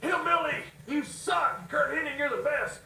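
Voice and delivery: high, loud heckling yell; a fan shouting from the crowd; retold fan yell. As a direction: bellow it from the cheap seats. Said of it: "some fan yells".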